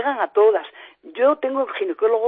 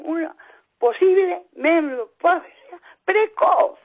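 tearful; 8 semitones higher, slower than usual. Real, not acted. Victim recounting her illness.

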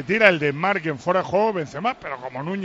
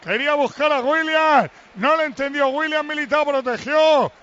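pitched-up match commentary, phrase after phrase; live play-by-play; 7 semitones higher than usual. Real, not acted. Commentator calling Atleti's attack to listeners, live play-by-play. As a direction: call the match live, ringing out each phrase.

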